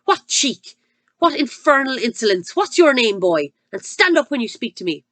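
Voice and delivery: furious headmistress. Acted. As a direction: tear into the boy. Headmistress berating a boy.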